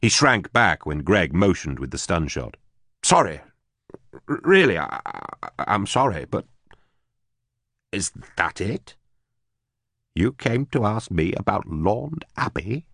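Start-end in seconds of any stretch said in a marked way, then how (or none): 3.0-12.8 nervous stammer. Acted. Frightened captive stammering.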